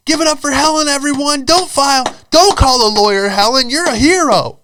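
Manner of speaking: loud, very high hammed-up shouting; hyping sales manager. Manager desperate that Helen won't sue, frantic loud mock hype.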